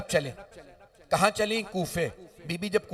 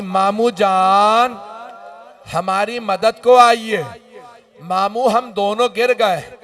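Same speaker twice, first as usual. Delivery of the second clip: loud, slow, stretched cry to his uncle; desperate call for help; 3 semitones higher, 6 dB louder, slower than usual. Acted. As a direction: drag out मामू जान as a wounded plea. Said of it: "आवाज दी". (ज़ख़्मी बच्चे की धीमी, ऊँची पुकार)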